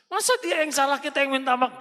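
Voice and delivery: high mock-outraged protest; can't believe it's unfair; indignant husband.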